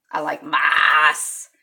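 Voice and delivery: slow, drawn-out mock Rastafarian "mas"; fake Rastafarian accent. Shown as a demonstration. Host admits her Spanish "mas" sounds like a fake Rastafarian accent. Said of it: "my fake phony Rastafarian accent".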